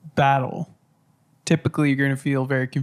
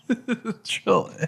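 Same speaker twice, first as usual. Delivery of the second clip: laughing; slower than usual. Real, not acted. Echoing joke while laughing.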